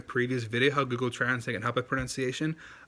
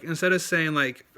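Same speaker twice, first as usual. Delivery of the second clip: ordinary talk, nothing marked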